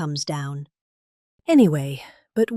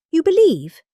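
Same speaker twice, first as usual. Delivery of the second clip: high, louder mock disbelief; incredulous; 8 semitones higher, 4 dB louder than usual. Real, not acted. Speaking to the crowd about lobster rules, incredulous and high. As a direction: asks the crowd if they can believe it.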